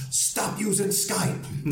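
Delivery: high, drawn-out mock yell; imitated yelling. Friends' exasperated plea, acted out.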